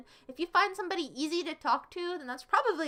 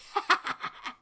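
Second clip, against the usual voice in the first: short breathy snicker; a mean little snort of a laugh; 3 semitones higher than usual. Real, not acted. Streamer caught laughing meanly at a chatter. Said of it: "that laugh was overly mean".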